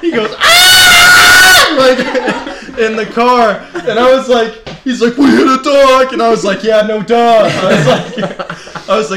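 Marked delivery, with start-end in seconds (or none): 0.4-1.8 very high, piercing mock scream; girlish scream. Imitating his companion screaming in the car, girlish scream. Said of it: "starts screaming like a girl". 5.1-6.1 loud, high mock-panicked shout; panicked yell. Imitating his companion after hitting a dog, panicked yell. 6.6-7.5 loud, high, worked-up retort; shouted comeback. Retold or imitated. Retelling his answer to his screaming companion, shouted comeback. Shouting back in the retold panic.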